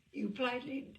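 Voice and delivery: plain reading voice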